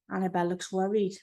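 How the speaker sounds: ordinary delivery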